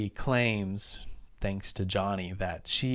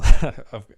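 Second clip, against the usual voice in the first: sharp burst of laughter; a real laugh that bursts out; loud laugh; 2 semitones higher, 15 dB louder than usual. Real, not acted. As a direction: laugh at how absurd it gets. Laughs while retelling plot.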